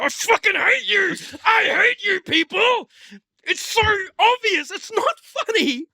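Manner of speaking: high, loud, strained outburst; exasperated rant. Acted. Film-goer raging at people who laugh, high shouted exasperation. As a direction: wail your hatred at the whole crowd.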